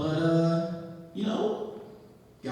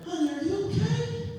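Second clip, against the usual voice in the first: lightly voiced old lady, much higher; higher-pitched concerned old lady, lightly imitated. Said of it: "this old lady came up".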